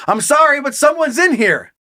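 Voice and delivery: insistent, high-pitched call; speaking up. A narrator's reading. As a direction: speak up to whoever is at the door. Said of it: "I speak up a little more".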